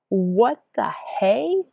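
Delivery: high, slow stunned question; disbelief. Retold or imitated. Seeing her time on the watch, in slow disbelief. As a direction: drag it out, can't believe it.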